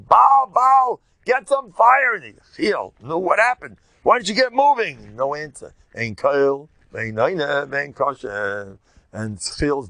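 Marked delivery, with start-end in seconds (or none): 0.0-2.1 high, loud frantic cry to an idol; screaming to Baal. Retold or imitated. False prophets shrieking at Baal for fire, high and loud. 3.0-5.2 higher, louder panicked demand; frantic urging. Retold or imitated. Prophets yelling at Baal, higher and louder.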